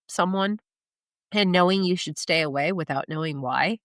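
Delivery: ordinary talk, nothing marked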